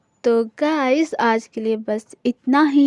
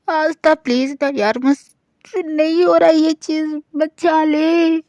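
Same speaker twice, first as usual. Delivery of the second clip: higher, louder, drawn-out begging plea; higher, louder girlish whine pleading for rescue; whiny pleading; 4 semitones higher, 5 dB louder than usual. Acted. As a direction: beg your best friend like a spoilt child, stretching the last words slowly. Friend begs to be rescued.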